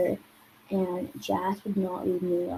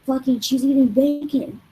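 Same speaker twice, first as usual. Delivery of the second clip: higher, louder mock outburst; imitated angry screaming; 5 semitones higher, 6 dB louder than usual. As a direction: mimic the friend yelling in outrage. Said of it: "he just started screaming at that too".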